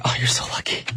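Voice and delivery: envious hushed aside; whispering. Real, not acted. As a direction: whisper it with mock envy. Envious reply to listener.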